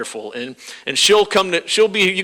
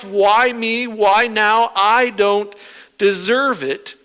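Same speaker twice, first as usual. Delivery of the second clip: rising, louder, pleading why me; whining at God, getting louder; mock complaint to God; 5 semitones higher, 4 dB louder than usual. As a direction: build from a sulky whine to a loud protest. Voicing a complaining believer to God.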